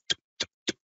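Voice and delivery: soft unvoiced tuk tuk tuk sting sound; stinging noise. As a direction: click out three quick stings under your breath. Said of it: "the scorpion stings him three times".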